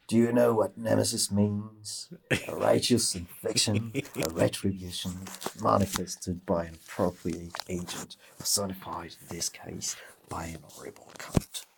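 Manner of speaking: quoted film line, sinking into a soft whisper by the end; movie villain quote. Retold or imitated. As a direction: recite it like the gangster from Snatch. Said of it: "to say".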